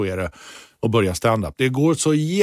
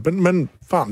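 ordinary delivery

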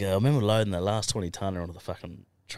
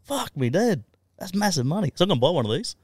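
high, eager, louder talk; excited boast; 10 semitones higher, 4 dB louder than usual. Retold or imitated. Husband telling wife about big money, excited and high-pitched. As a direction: get fired up about the money. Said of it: "I turned to my wife and said".

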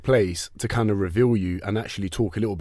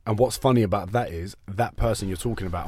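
ordinary delivery

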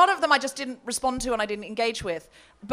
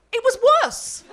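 laughing; 11 semitones higher, 3 dB louder than usual. Real, not acted. Laughing reveal of worse result.

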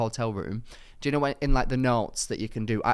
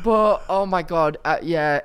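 normal talking voice

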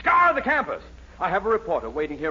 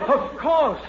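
eager exclaim; 7 semitones higher, 3 dB louder than usual. Acted. Dean gushing over the genius.

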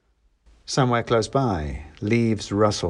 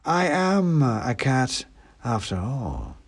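snooty bored cat; 4 semitones higher, slower than usual. A narrator's reading. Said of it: "came the voice".

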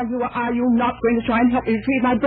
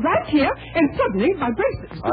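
distressed; 8 semitones higher than usual. Acted. Robbery victim in distress.

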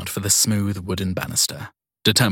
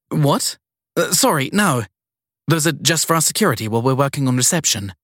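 put-on flustered young woman; 6 semitones higher, 3 dB louder than usual. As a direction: caught off guard and eager to please. Sadie caught off guard.

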